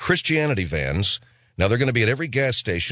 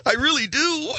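high giggling laugh; giddy laugh. Real, not acted. Comic frets about doom, laughing high and nervous.

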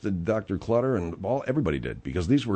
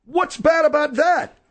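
incredulous; 4 dB louder, slower than usual. Acted. Mock-innocent defense of poker game.

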